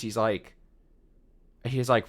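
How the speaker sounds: ordinary talk, nothing marked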